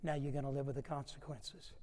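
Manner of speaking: put-on soft, firm teacher to a child; lightly voiced teacher. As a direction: set the boundary calmly. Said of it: "she would say".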